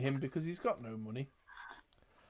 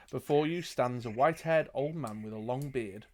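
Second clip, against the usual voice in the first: usual speaking voice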